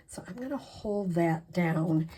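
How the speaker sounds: murmured under her breath while concentrating; whisper. Real, not acted. Crafter holding the ribbon down for viewers, whispering.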